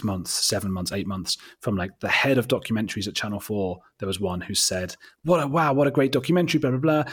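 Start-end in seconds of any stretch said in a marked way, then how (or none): 5.2-7.0 higher, louder quoted enthusiasm; retold gushing praise. Channel Four executive praises the documentary, gushing in retelling. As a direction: gush lightly, as if quoting someone impressed.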